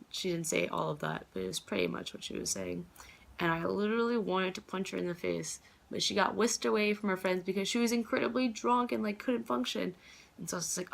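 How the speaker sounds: ordinary talk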